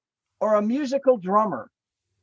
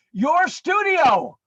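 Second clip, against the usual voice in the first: slow, drawn-out, higher and louder; eager answer; 10 semitones higher, 5 dB louder, much slower than usual. Retold or imitated. [name] tells James where he really wants to go, high, slow and eager. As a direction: stretch it out like the punchline. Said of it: "And I went".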